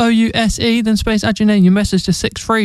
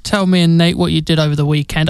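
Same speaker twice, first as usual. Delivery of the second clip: normal talking voice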